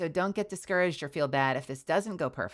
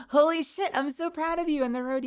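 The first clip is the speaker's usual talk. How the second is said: excited; 13 semitones higher, 4 dB louder than usual. Acted. Reading hype message.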